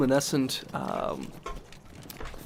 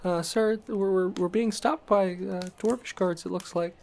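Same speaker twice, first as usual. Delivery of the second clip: higher-pitched, hesitant servant voice; hesitant coachman; 7 semitones higher than usual. Acted. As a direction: report timidly to your master in a higher voice. Game master voices hesitant coachman.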